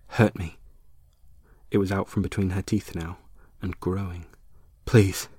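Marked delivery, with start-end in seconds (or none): 0.1-0.6 loud, insistent hurt me; urgent demand; louder plea. Acted. Repeats plea louder, growing. 4.9-5.3 loud aching please; urgent begging please; pleading. Acted. Plea growing.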